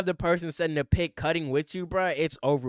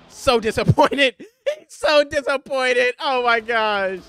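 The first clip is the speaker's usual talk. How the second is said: higher, louder, slow, laughing exaggeration; mock disappointed; 7 semitones higher, 9 dB louder, slower than usual. Real, not acted. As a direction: stretch it out laughing at him. Mocking disappointed player, laughing.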